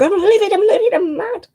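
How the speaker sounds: high, loud, outraged; imitating an angry rant to the estate agent; livid. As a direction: retell the phone rant at full outrage.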